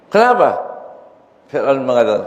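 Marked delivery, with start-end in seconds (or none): 0.1-0.6 surprised Pharaoh. Acted. Pharaoh asks the devil why.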